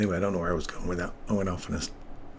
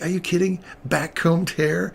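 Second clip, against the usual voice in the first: retold excitement; 8 semitones higher, 4 dB louder than usual. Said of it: "I'm like".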